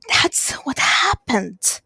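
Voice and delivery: slow, breathless whispered plea; tearful whisper. Acted. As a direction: force it out through tears. Dying man swears it's true.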